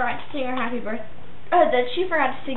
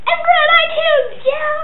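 high, loud burst of joy; excited; 8 dB louder than usual. Real, not acted. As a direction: cheer it out. Excited announcement of podcast.

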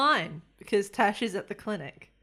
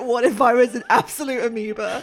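dim character; 8 dB louder than usual. Retold or imitated. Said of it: "the Namazit was like".

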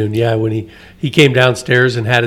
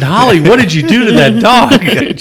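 higher, louder, half-joking shock; mock alarmed question; 9 semitones higher, 5 dB louder than usual. Asking Holly about the dyed dog, mock alarm. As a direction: sound stunned at the dog's color.

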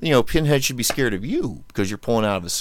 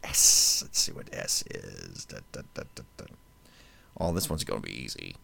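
much lower, softer mumble; muttering to self; 8 dB quieter than usual. Real, not acted. Host searching his list, muttering under his breath. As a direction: mutter it half to yourself.

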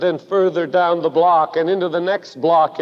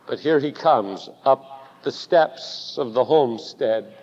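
usual speaking voice

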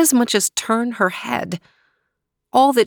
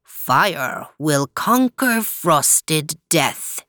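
very slow, low, otherworldly bird; slow ominous condor prophecy; 4 semitones lower, much slower than usual. A narrator's reading. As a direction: intone it like an ancient bird. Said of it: "The condor said as it wheeled low".

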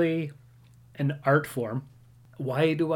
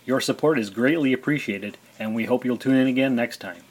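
louder, upbeat podcast outro read; announcer read; 5 dB louder than usual. Acted. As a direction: read it like a show sign-off.

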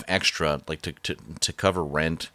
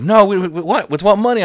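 much higher, louder, incredulous squeal; shocked ex; 12 semitones higher, 9 dB louder than usual. Retold or imitated. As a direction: mimic her stunned disbelief, way up high. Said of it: "She's like".

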